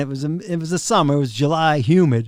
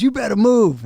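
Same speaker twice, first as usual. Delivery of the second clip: drill sergeant; 8 semitones higher than usual. Retold or imitated. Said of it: "like a drill sergeant".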